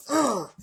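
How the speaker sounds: sudden loud high groan of frustration; angry groan. Retold or imitated. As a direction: let out an angry ugh from nowhere. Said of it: "I make weird sounds".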